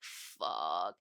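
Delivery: very low, drawn-out curse; reluctant dismay. Retold or imitated. Told to call the police, low reluctant dismay. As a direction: let one low word sink in dread.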